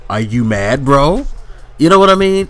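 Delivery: high, loud taunting question; mocking shout. Real, not acted. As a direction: throw the taunt back loudly. Mocking commenters, rising shout.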